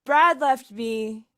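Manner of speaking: Kevin, loud and upbeat, consoling; chirpy surfer-type friend cheering them up; Kevin in character. Acted.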